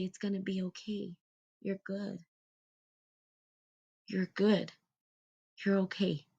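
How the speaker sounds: soft, quiet reassurance; soothing inner mother. Acted. Inner mother soothing her inner child, soft and reassuring. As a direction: comfort a frightened child. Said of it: "hold my hands to my heart and say".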